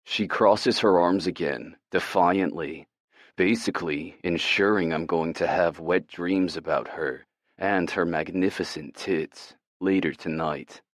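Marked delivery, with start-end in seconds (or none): none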